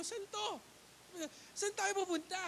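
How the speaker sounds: high, puzzled asking; surprised question. Retold or imitated. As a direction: nagtatakang tanong, mataas ang boses. Surprised at driver's odd turn. (nagulat na tanong)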